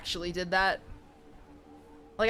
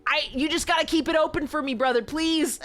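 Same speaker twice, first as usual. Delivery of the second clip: higher, louder pleading to a patient; 6 semitones higher, 4 dB louder than usual. Retold or imitated. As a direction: beg the patient to keep it open. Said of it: "I'm like".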